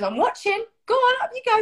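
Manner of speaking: high-pitched egging on; high, insistent sing-out; bossy coaxing. Retold or imitated. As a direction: imitate a pushy friend sending someone off. Imitates Debbie pushing her on.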